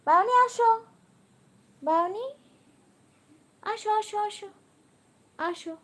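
high-pitched coaxing call; calling a pet. Real, not acted. Owner calling Browny to come, high coaxing call.

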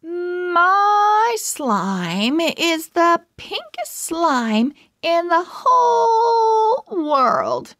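high, slow, sing-song boast; proud declaring girl. A narrator's reading. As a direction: show off your slime, drawn out and high. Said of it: "Melanie declared".

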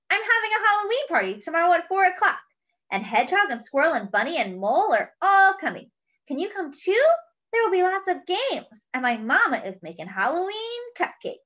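high, fast, bubbly mouse voice; a small mouse rattling off party plans; excited young mouse chattering; eager little mouse. Acted. Mouse invites Pig eagerly.

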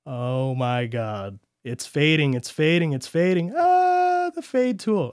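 delighted squeal building up; excited. Real, not acted. Fade tool works, he exclaims.

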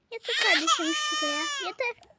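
tearful insistence. Acted.

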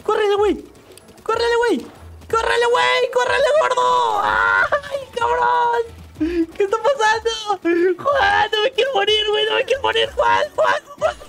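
very high frantic shouts; terrified screaming. Real, not acted. Gamer chased by a monster, terrified screaming. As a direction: scream for your life.

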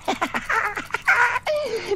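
high, gleeful little goblin giggle; sly high-pitched cackle at a secret plan; high impish giggle. Acted. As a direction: giggle to yourself like a mischievous imp. Pumuckl gleeful about escaping.

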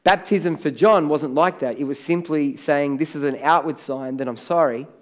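normal talking voice

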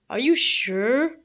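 normal talking voice

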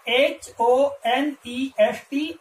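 letter by letter, very slow; slow spelling out. Shown as a demonstration. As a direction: spell H O N E S T out slowly. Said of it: "तो वो क्या है".

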